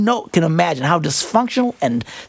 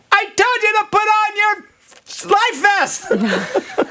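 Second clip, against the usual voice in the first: high-pitched, drawn-out exasperated holler; mock yelling; much slower than usual. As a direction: yell it way up high, hammed-up. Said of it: "I'd be yelling".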